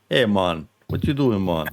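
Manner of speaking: lightly put-on Jamaican accent; a hint of mock Jamaican lilt; botched Jamaican accent. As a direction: attempt Scottish and land in loose Jamaican. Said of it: "I pretend I'm Scottish and I sound Jamaican".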